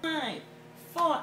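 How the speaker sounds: ordinary talk, nothing marked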